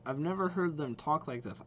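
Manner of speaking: ordinary delivery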